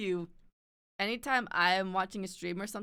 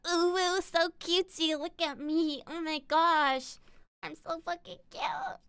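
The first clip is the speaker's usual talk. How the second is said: put-on high, squeaky sugary girl voice; mock cutesy; 9 semitones higher than usual. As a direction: gush over your own cuteness, mockingly. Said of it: "detestable gremlin instead of a".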